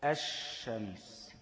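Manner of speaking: الشمس drawn out very slowly as ash-shams, l silent; slow demo of sun letter. Shown as a demonstration. Teacher gives students the model word, الشمس slow, no l. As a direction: stretch الشمس slowly, doubled sh and no l. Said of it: "اللام الشمسية مثالها إيه؟".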